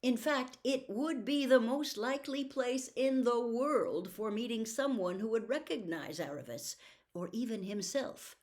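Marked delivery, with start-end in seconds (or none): none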